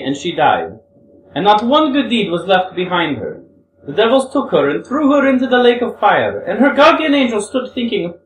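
a hint of a young woman, earnest and pleading; lightly voiced Grushenka. Reader voices Grushenka telling fable.

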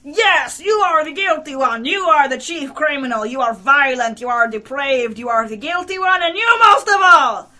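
loud, high, hammering accusation; screamed accusation. A narrator's reading. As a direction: scream it, building on the last words. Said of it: "screamed the commissioner".

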